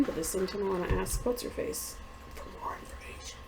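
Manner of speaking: unvoiced whisper under the breath; whispered aside. Real, not acted.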